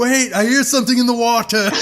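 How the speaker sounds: high, loud excited call; hammed-up sailor shout; mock sailor. Voicing the sailors.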